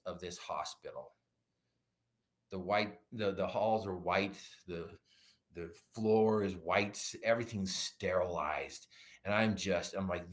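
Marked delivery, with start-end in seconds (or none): none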